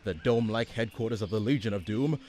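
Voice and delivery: ominous cartoon narrator voice; dramatic announcer. Announcer setting the villains' lair scene, ominous. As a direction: paint the swamp lair with menace.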